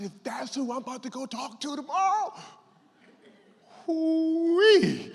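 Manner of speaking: building to a loud, high whoop with a laugh; delighted hoot of amazement; thrilled. Retold or imitated. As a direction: let the excitement burst out at the end. Retelling his thrilled reaction.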